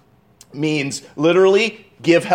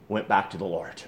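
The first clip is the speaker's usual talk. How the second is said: hushed; 8 semitones lower, 10 dB quieter than usual. Acted. Preacher drops to hushed tone.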